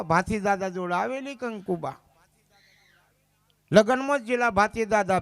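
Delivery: usual speaking voice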